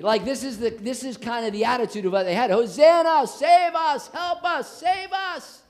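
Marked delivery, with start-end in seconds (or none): 2.5-5.6 high, loud shouted plea; crowd shouting hosanna. Retold or imitated. Preacher voices the Palm Sunday crowd, high shouting plea. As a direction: cry out as the whole crowd. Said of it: "They're shouting out as people".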